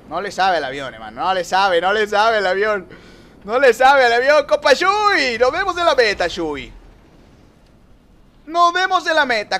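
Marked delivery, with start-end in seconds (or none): 3.4-9.6 high, loud jeer at a rival; triumphant high-pitched hollering; taunting shout. Real, not acted. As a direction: yell a gleeful goodbye as you pass him. Taunts rival while overtaking.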